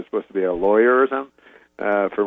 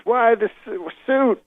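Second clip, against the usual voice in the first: high, broken-off Cosby question; imitating Bill Cosby; Cosby impression; 10 semitones higher, slower than usual. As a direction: climb high on suit as Cosby. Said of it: "He goes".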